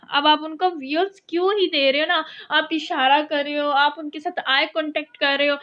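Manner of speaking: ordinary delivery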